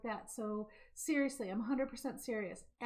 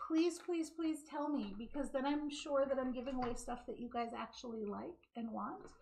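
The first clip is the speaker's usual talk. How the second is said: usual speaking voice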